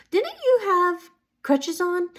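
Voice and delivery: high, slow, incredulous asking; astonished question. Retold or imitated. Greeter to man leaving without crutches, astonished question.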